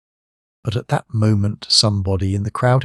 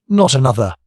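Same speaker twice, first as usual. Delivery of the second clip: a mock shriek, higher and louder; crowd shriek; 4 semitones higher, 4 dB louder than usual. A narrator's reading. Crowd agreeing no one else could shoot, shrieking. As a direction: cry out in eager agreement. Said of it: "shrieked everybody".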